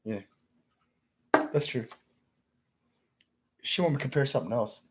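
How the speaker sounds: ordinary talk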